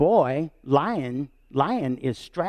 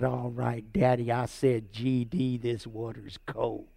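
put-on deadpan little boy; 5 semitones lower, 6 dB quieter, slower than usual. Boy repeats the curse deadpan.

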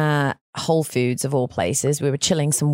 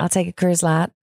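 put-on Texan accent. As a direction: say it like a Texan, as an English outsider. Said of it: "That's how they say it".

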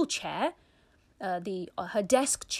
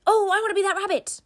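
put-on little boy's eager wish; 11 semitones higher, 8 dB louder, much faster than usual. Little brother's wish voiced.

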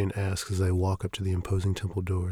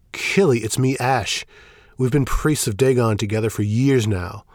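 urgent; 3 semitones higher, 7 dB louder than usual. Acted. Priest pleading with dazed friend.

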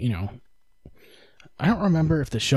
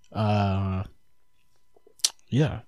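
normal talking voice

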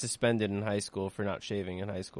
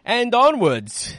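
high, loud, drawn-out call; mock rallying cry; 12 semitones higher, 8 dB louder, much slower than usual. Host moving the show on, mock-heroic cry. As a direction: call the troops forward, long and ringing.

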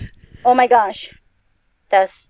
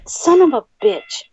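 ordinary talk

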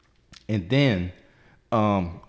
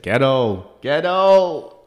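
drawn-out, rising-louder call of disbelief; mock exclamation; 5 semitones higher, 6 dB louder, much slower than usual. Teller mocking the lap-sitting couple to a friend, exclaiming. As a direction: call it out slowly, higher the second time.

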